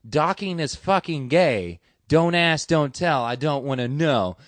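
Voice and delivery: loud, slow, final ruling; stern sergeant bark. Retold or imitated. First sergeant ending the soldiers' argument with a loud, slow verdict. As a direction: shut the talk down like a fed-up first sergeant. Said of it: "looks over and goes".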